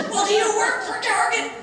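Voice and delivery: high, loud mock yell; mad mother. Mother challenging the stranger in Target, high angry shout. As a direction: hurl it as an outraged challenge. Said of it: "She was just really mad".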